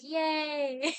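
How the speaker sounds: high, drawn-out yay; small gleeful imitation; acted little cheer. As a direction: cheer in a cute mock-child voice. Happy daughter, acted out.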